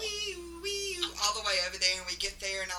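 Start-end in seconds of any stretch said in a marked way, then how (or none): 0.0-1.1 high siren wail; wee-oo like an ambulance. Retold or imitated. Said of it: "acting like an ambulance".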